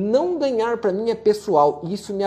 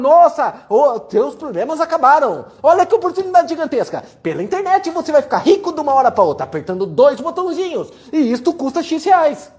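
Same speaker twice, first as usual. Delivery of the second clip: higher, louder, hyped-up sales pitch; mock infomercial; 5 semitones higher, 4 dB louder than usual. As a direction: ham up a TV scam ad. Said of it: "alguém falando". (imitando locutor de comercial exagerado)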